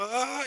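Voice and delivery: high-pitched mock gasping cry; imitated shock. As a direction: act out a patient's shocked "ah". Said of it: "Y ella como".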